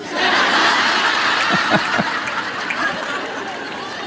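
long, high airy laugh; breathy laugh. Real, not acted. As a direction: laugh hard at the punchline. Laughing at the punchline.

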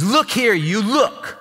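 high, scornful "Look here, you"; disrespectful "look here". Acted. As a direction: sneer it at your own father. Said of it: "This guy just says".

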